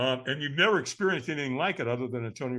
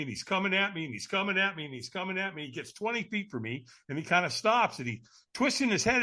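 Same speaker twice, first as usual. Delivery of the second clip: ordinary talk, nothing marked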